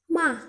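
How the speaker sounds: "ma" high then dropping abruptly; stamped fourth tone. Shown as a demonstration. Teacher repeating the fourth Mandarin tone for beginners, stamped "ma". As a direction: hit "ma" hard and let it fall. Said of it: "intonasi menghentak".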